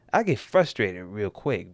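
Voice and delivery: normal talking voice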